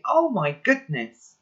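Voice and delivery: slow, loud pretend shock while pointing; staged exclamation of surprise; fake alarm. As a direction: fake a startling sight to distract them. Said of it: "I point and say".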